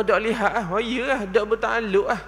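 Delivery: ordinary delivery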